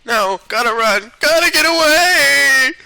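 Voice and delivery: high-pitched hammed-up panic; mock-terrified wail, rising to loud; panicked fleeing. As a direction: cry out and stretch the last words. Voices fleeing corpse in a panic.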